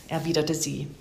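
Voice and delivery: ordinary delivery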